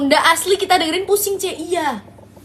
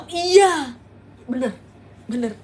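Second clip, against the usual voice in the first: usual speaking voice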